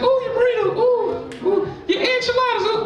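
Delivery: high, cooing female voice; high mock woman. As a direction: coo it up high like her. Woman voiced in sex joke.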